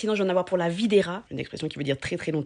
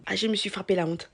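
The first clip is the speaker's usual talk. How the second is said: ordinary delivery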